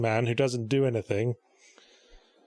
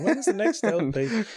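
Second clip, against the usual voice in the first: higher-pitched mimicry of nagging fans; pestering fans; 7 semitones higher than usual. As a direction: imitate impatient viewers begging. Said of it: "on my YouTube account going".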